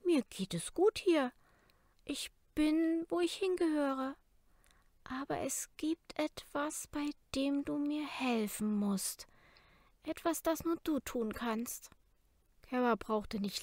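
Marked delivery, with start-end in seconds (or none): 0.0-11.9 put-on calm young girl, higher and tender; gentle girl avatar. A narrator's reading. Narrator voices Leni's avatar.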